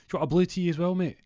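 high-pitched teasing question; mocking. Host ribbing his mate over ordering green tea, mocking. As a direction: take the mick out of the fancy order.

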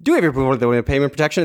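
rattled off at top speed; rushed auctioneer voice. Retold or imitated. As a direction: blur the question out fast. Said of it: "they would go like".

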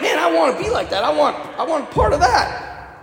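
high-pitched, eager wanting; acted-out excited longing; eager admiration. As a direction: gush like an impressed onlooker. Voices admiring onlookers' longing.

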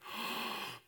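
soft acted gasp of shock; quiet horrified gasp. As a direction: suck in a hushed, shocked breath. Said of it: "this shock of like".

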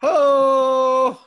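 short, loud, high "Ho!"; hype whoop. Real, not acted. Host answering his cohost's cheer with a loud whoop. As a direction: bark out a celebratory whoop. Podcast hosts cheering Google ranking.

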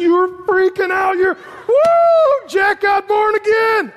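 high, loud whooping celebration; wildly excited. Acted. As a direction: shout it like a jackpot win. Acting out an evangelist's excitement.

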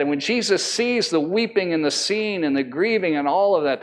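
usual speaking voice

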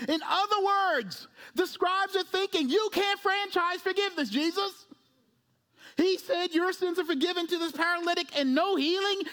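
high-pitched, driving sermon delivery; impassioned preaching. Real, not acted. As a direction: build the argument with urgent pulpit force.

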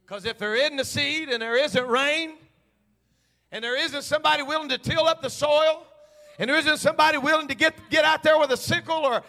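loud, high, driving sermon cadence; full-force preaching. Acted. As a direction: preach it to the rafters.

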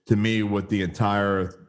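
ordinary delivery

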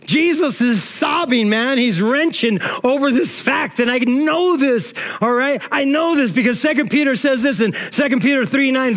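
fervent preacher, emphatic and driving; preaching at full force. Acted. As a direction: hammer each line home to the congregation.

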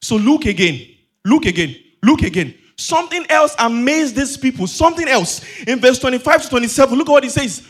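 loud, emphatic call to attention; forceful preaching. Real, not acted. Preacher sends congregation back to the text, loud forceful preaching. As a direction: urge the congregation with punched repeats.

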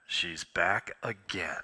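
loud breath, then slow low whisper; exasperated inner voice, drawn-out and low; little voice in head. Retold or imitated. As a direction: heave a big sigh and whisper it slowly. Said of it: "a little voice in my head said".

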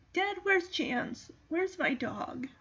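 teared up. Real, not acted. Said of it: "now I'm starting to get teared up".

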